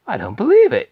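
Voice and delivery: loud, high astonished cry; disbelief. Acted. Mr Bucket told of the ticket, loud high disbelief. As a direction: gasp it out amazed.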